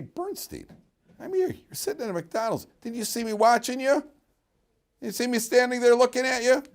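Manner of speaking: high, rising indignant confrontation; indignant rabbi. Retold or imitated.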